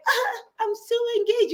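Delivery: high, sugary fake delight; mocking a fake happy bride; mock fake gush. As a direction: gush like a forced fiancée. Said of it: "it's so fake".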